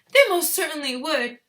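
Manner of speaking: high, loud, indignant woman's voice; Missus Gloop. A narrator's reading. Father's retort read in the mother's voice, indignant. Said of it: "I read it in Missus Gloop's voice".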